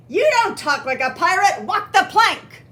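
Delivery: loud, high pirate shout of dismissal; full-voiced pirate roar; bellowing. A narrator's reading. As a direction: bellow the order like a furious captain. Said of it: "bellowed Pete".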